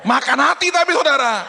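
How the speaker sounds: very high, loud preacher's shout; sudden comic yell of frustration; exclaimed outburst. Real, not acted. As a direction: burst out at full volume to the congregation. Bursts out after mock gentleness.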